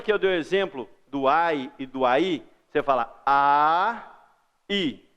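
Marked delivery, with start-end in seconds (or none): 3.2-5.0 long "a", pause, then "i"; separate vowels. Shown as a demonstration. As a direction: finish the "a" completely before starting "i". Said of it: "Termina o A, vai pro I".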